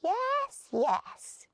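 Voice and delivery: high, quick eager yes. Acted. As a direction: gnomes eagerly agreeing, a light mock-eager voice. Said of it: "said two of the others eagerly".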